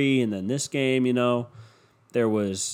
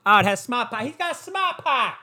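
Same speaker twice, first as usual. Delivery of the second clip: put-on Boston accent; 10 semitones higher than usual. Mimicking the Hyundai commercial, hammed-up Boston accent. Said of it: "they're fucking talking in that Boston accent".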